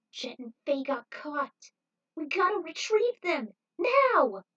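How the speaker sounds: rising to a high, loud now; Trudy insisting they rescue friends, building loud; desperate demand. A narrator's reading. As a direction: push harder each word.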